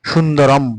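সুন্দরম closed firmly on "m"; final M sounded. Shown as a demonstration. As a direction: demonstrate "shundorom" with সুন্দরম ending in a hummed m. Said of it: "সেটা ম উচ্চারণ করা হয়". (সুন্দরম-এর শেষে স্পষ্ট ম)

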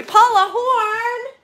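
loud, high, drawn-out hello; delighted greeting. Real, not acted. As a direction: call out joyfully to a friend. Greets the super chat sender.

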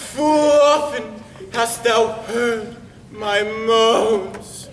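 loud, slow, overwrought tragic maiden; hammed-up Thisbe. [name] rehearsing as Thisbe, hamming up the grief. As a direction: wail it like a melodramatic heroine.

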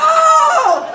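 a loud, high laughing whoop; laughing shouted oh. Acted. Storyteller whooping at the crowd, high laughing shout. Refrain exclamation to audience.

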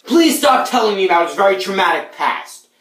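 furious high-pitched shout; way too angry. Acted. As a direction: yell the plea in overdone anger. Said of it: "That was way too angry".

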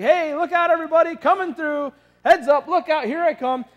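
high, loud, happy warning calls to a crowd; cheerful heads-up calls. Retold or imitated. Garbage guy clearing shoppers from his path, cheerful loud calls. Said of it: "Just this, this happy".

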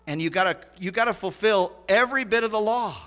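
loud, high, hammered-out preaching; forceful preaching. Real, not acted. Preacher drives point home.

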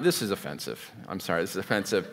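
low apologetic murmur; hushed aside. Real, not acted. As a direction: drop the voice as if admitting something awkward. Confessing shock at Jesus' silence.

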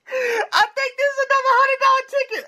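very high, squealed through excitement; excited squeal. Real, not acted. Scratcher sensing another hundred dollar ticket, excited squeal. Thrilled at a possible winning ticket.

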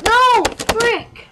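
sudden high furious shout; real gamer rage outburst; frustrated yell. Real, not acted. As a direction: scream it out as the game kills you. Chicken kills him in game.

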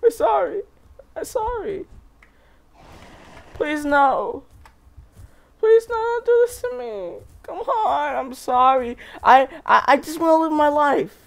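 frightened pleading. Acted. Small dinosaur begs its attacker, high and frightened. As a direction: plead for your life in a high, scared voice.

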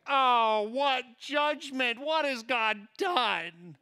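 high, slow, hammed-up alarm; mock panic. Preacher playing a guilty diner, loud mock dread. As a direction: whine it out like guilt just caught up with you. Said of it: "you're like".

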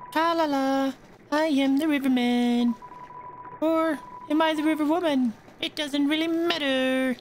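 very high sing-song river woman. Acted. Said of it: "I'm gonna give her a sing-song voice".

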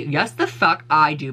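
slow, every word spelled out; slow emphatic. Acted. Demanding credit for the blanket, slow emphatic. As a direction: drag it out to make the point. Insisting she deserves credit.

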